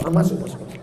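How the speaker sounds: ordinary talk, nothing marked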